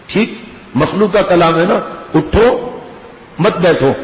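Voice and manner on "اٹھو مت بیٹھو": اٹھو lifted, a gap, then مت بیٹھو as one phrase; group مت with بیٹھو, apart from اٹھو; pause after اٹھو. Shown as a demonstration. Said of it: "ترجمہ غلط ہو گیا".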